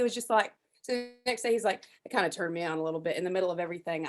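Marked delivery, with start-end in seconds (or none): none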